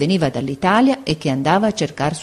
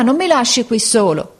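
high, louder pleading; 6 semitones higher, 3 dB louder than usual. A narrator's reading. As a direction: beg not to be left alone. Boy begging the capataz. (supplica acuta)

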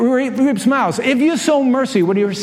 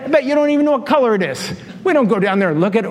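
ordinary delivery

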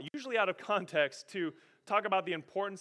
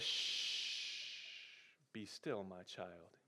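whispered, much softer and lower hush; God hushing; 11 semitones lower, 13 dB quieter, much slower than usual. Acted. God calming a worried believer, soft whispered hush. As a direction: hush a frightened child.

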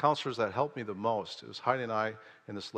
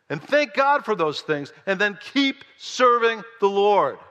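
high, loud, building sermon cry; full-force preaching; 7 semitones higher, 7 dB louder than usual. Acted. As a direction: preach it like Paul, rising to a climax. Preaches Paul's message at full force.

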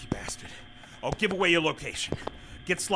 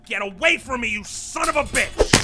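loud, high, angry yell; furious shout; 6 semitones higher, 6 dB louder than usual. Acted. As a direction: scream it while fighting him off. Fighting off attacker.

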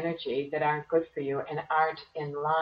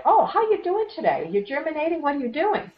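much higher, louder, sing-song mock fuss; fussing over a seed; 11 semitones higher, 6 dB louder than usual. A gardener digging up her seed to check on it, mock fussy and high. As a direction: coo at it like an anxious gardener.

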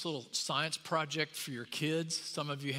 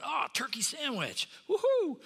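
higher, whooping with a big leap up on woohoo; gleeful scout ant; 10 semitones higher than usual. Pretend. Scout ant finding a turkey sandwich in the basket, whooping. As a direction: cheer at the sandwich find. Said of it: "and they're going".

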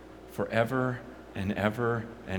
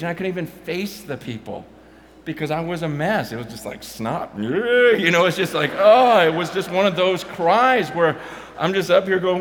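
impassioned preaching; 7 semitones higher, 9 dB louder than usual. Acted. Preacher builds to full force.

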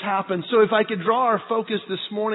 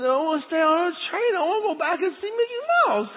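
high mock-whining little boy; upset child; 9 semitones higher than usual. Boys not leaving the train, mock upset. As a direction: imitate a kid working up to tears. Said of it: "they started getting upset".

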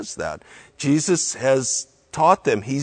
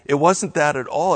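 ordinary talk

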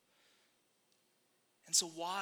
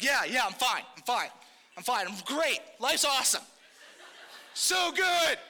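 mock-insisting all is well, growing higher and louder; fake fine; 3 semitones higher, 4 dB louder than usual. Upset friend answers "are you okay", overdone fake fine. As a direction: overdo the cheer to hide being upset. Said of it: "so angry and so upset".

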